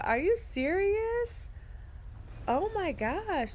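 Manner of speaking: high, slow and amazed; delighted surprise. Real, not acted. Streamer reacting to a fan waking at 3 AM, amazed. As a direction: stretch it out, touched by the fan. Reading a fan's 3 AM comment.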